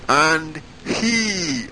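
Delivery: "And he" lifted high and leaned on hard; stress on and he. Shown as a demonstration. Preacher stressing "And he" for the congregation, emphatic. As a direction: hit "And he" so the congregation hears it. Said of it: "I emphasize that word".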